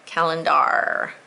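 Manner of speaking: "Calendar" slowly, with a long "dar" ending; stressed ending. Shown as a demonstration. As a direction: dwell on the final "ar" of "Calendar". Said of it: "really think about that ending R".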